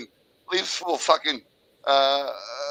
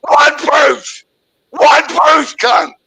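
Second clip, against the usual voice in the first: loud, high repeated yelled demand; furious shouting; 7 semitones higher, 8 dB louder, slower than usual. Real, not acted. Caller screaming at Gordon for one proof, furious shouting. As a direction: hammer the demand, yelling.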